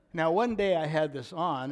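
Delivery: normal talking voice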